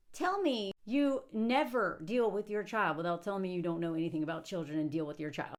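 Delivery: usual speaking voice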